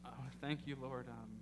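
soft, earnest closing prayer. Acted. Pastor leading the church in prayer, soft and earnest. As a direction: pray quietly and humbly.

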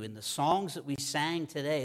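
usual speaking voice

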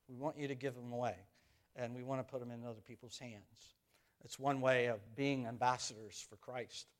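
ordinary talk, nothing marked

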